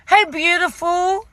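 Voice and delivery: high, drawn-out friendly hail; chirpy staff greeting. Retold or imitated. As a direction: imitate a gushing shop assistant. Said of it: "one of the staff come up to me and say".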